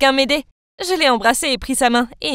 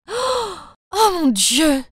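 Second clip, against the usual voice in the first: a sudden high gasp; shocked gasp; 5 semitones higher, much slower than usual. Acted. Girl reading the letter in the box, high shocked gasp. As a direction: gasp at what the letter says.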